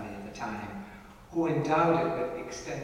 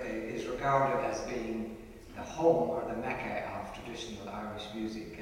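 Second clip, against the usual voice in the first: ordinary delivery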